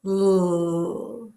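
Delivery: held L, lips loose; L with relaxed lips. Shown as a demonstration. Teacher shows relaxed lips for L. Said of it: "When you make the L sound".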